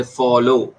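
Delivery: फॉलो said deliberately on its own; teacher modelling both syllables of फॉलो; two-syllable example. Shown as a demonstration. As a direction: present फॉलो clearly as an example word. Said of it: "double syllables बोले तो".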